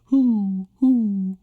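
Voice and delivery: put-on high hooting baby owls; owl hoots. Teacher imitates baby owls in the nest, high hoots. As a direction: hoo like a little owl.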